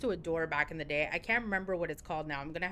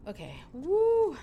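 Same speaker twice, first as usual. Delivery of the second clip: loud high slow woo; self-pep cheer; 10 semitones higher, 7 dB louder, slower than usual. Real, not acted. Streamer rallying herself before the game, loud cheer. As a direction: psych yourself up before a run.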